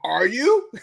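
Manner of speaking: normal talking voice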